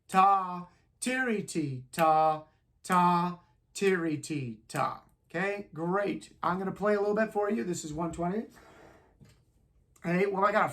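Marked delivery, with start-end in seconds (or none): 0.1-5.0 tiri, ti, ta chanted loud in time; rhythm syllables. Shown as a demonstration. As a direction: count the rhythm aloud. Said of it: "We're right".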